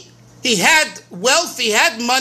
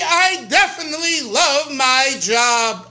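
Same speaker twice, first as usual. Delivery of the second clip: slow, firm declaration with weight on "I"; triumphant answer. Shown as a demonstration. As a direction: land "I definitely love my job" slowly and firmly. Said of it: "voice goes up and deep".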